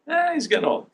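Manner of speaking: high, offhand brushing it off; dismissive shrug. Retold or imitated. Dog owner shrugging off the old dog, dismissive. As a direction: wave it away like it's nothing. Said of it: "you go".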